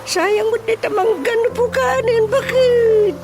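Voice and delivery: high startled protest; alarmed outcry. Acted. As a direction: cry out in shock at the news. Old man alarmed mango tree cut.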